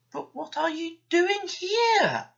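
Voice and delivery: high, slow, puzzled engine voice; Douglas the engine. A narrator's reading. Narrator voicing Douglas meeting a stranger, high and puzzled. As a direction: ask it wide-eyed as the big engine.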